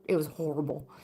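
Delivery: low-key and soft, remembering something bad; quiet recollection. Real, not acted. Storyteller closing a frightening memory, subdued. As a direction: say it softly, almost to yourself. Recalls the apparition, then repeats.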